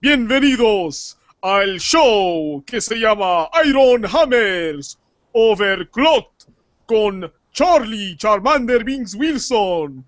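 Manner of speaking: high drawn-out ring announcer; lucha libre announcer. Acted. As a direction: announce the show like a wrestling MC. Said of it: "I'm gonna start it like this".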